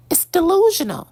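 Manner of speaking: high, sharp scornful jab. Real, not acted. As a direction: spit out the verdict in disbelief. Scorning the singer's choices.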